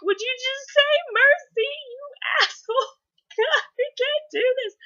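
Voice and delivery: very high, loud mock-weepy plea; crowd pleading tearfully. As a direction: squeal it like an overwrought crowd. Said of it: "The whole crowd's just like".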